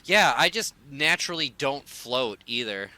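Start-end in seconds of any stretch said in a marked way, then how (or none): none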